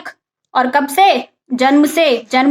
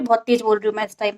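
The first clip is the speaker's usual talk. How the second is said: ironic hush; 5 semitones lower, 7 dB quieter, faster than usual. Shown as a demonstration. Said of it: "जो मैं अभी नहीं बोल रही".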